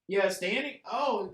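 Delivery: ordinary delivery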